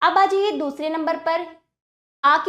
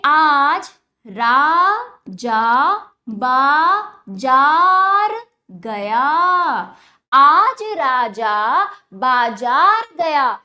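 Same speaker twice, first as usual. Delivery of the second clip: slow drawn-out reading; 8 dB louder, much slower than usual. Shown as a demonstration. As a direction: stretch every आ in आज राजा बाजार, slow and loud. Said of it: "और ज्यादा खींच के पढ़ा जाएगा". (बच्चों के लिए आ खींचकर धीरे और ज़ोर से पढ़ना)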